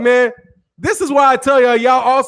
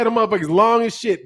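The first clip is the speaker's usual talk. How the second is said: ordinary talk, nothing marked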